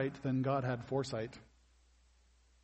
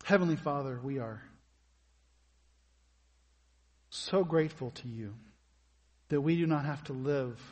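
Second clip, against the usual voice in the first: prayer; 3 semitones lower than usual. Acted. Leads closing prayer.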